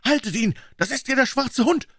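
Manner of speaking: put-on high-pitched urgent boy's shout; aufgeregter Ruf. A narrator's reading. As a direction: cry out the alarm, voice far up. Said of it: "rief ich". (hoher, dringlicher Jungenruf)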